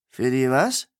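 baffled barkeep asking back; startled "was?" pushed up at the end; perplexed. A narrator's reading. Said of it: "fragte der Besitzer perplex".

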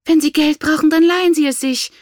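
high, loud angry retort; shrieked, impatient command; snapping. A narrator's reading. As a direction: lash out at your husband. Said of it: "fuhr seine Gemahlin ihn an".

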